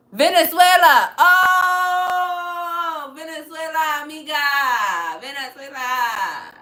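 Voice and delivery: excited greeting to a viewer from Venezuela; excited exclamation. Real, not acted. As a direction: draw out "amiga", thrilled.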